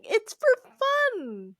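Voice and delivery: high-pitched, drawn-out protest; comic high-voiced exasperation; exasperated high plea. Real, not acted. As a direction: plead it way up in a squeaky register. Exasperated plea defending wrestling as fun.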